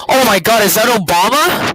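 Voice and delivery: surprised. Acted. Spotting Obama in the photo.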